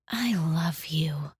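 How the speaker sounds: soft, slow whispered I love you; tender I love you. Shown as a demonstration. To a loved one, whispered tenderly. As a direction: breathe it gently, like a secret. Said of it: "interpreted by the tone of our voice".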